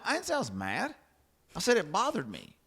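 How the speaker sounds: mock protest, I never said mad; indignant denial. Retold argument, denying being mad.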